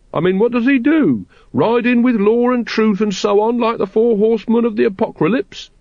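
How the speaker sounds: mock the legend mercilessly; mocking Watchtower. A narrator's reading. Watchtower ridiculing the prophecy to the brethren, high and mocking.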